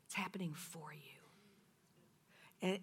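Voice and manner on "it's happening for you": hushed whisper of the key line; whispered repetition. Real, not acted. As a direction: let it sink in softly. Repeating God's word softly.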